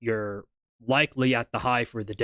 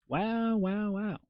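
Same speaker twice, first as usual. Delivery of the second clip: high, soft amazed wows; quietly stunned, pitch up high; surprised; 9 semitones higher, 6 dB quieter, slower than usual. Real, not acted. Surprised at chart move.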